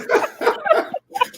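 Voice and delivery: high, airy laughter; wheezy high giggle; breathless laugh. Real, not acted. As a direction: keep cracking up. Host still laughing.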